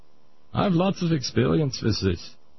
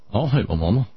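lower, lightly voiced patient accent, "I don't hate my mama" rising on "hate"; patient's accent; 7 semitones lower, faster than usual. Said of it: "how I'm able to do that accent".

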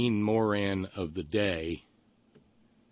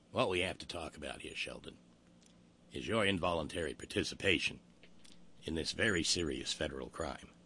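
Columbo voice; 6 semitones lower, 11 dB quieter, faster than usual. Retold or imitated. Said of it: "a competent Peter Falk to deliver".

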